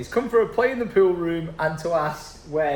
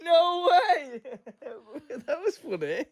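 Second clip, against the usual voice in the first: laughing; slower than usual. Real, not acted. Laughing at joke.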